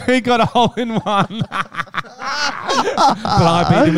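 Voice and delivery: laughing through the words. Real, not acted. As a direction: chuckle out the line. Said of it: "You're sucking a lollipop?".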